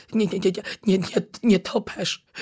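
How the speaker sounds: stuttering through chattering teeth from the cold; a shivering little bat stammering out its name; frozen bat stuttering nie, nie, nie before nietoperz. Acted. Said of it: "zadzwonił zębami zwierzaczek".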